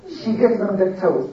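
usual speaking voice

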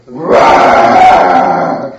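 deep, loud beastly roar; camel roar. Acted. Camel roars at the hero.

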